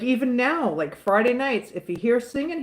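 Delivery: normal talking voice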